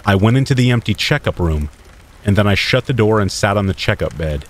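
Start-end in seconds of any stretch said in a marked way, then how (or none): none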